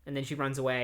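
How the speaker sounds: usual speaking voice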